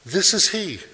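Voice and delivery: high, loud cry of announcement; ringing proclamation. Acted. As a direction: shout it out to the crowd. Quoting John proclaiming Christ.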